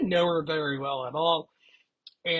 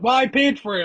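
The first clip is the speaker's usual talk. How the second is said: loud; 8 semitones higher, 7 dB louder than usual. Retold or imitated. Drunk speaker defends eating kebab.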